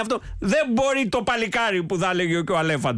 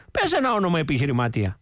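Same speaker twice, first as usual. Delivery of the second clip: ordinary talk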